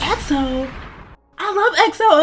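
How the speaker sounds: high-pitched gushing friend impression; imitated fan excitement. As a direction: squeal it like a thrilled fan. Friend's excited reaction retold.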